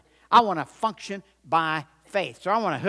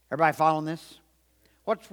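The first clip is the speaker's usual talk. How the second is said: usual speaking voice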